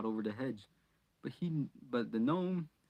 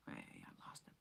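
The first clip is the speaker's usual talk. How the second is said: whisper, barely voiced; whispered aside; 20 dB quieter than usual. Real, not acted. Reader losing her place, whispering. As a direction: mutter it under breath.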